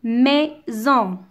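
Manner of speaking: "Maison" in two separate beats; split into syllables. Shown as a demonstration. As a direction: break "Maison" into mai, pause, son. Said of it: "deuxième syllabe".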